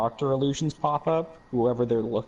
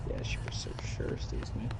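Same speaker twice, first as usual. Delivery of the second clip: tense unvoiced whisper, much softer; whispering while hiding; 12 semitones lower, 10 dB quieter, slower than usual. Real, not acted. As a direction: hush it so she won't hear. Hiding from the Huntress in game.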